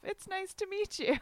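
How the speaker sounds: high, bright put-on politeness; sweet fake greeting. Mock self-introduction with pamphlet.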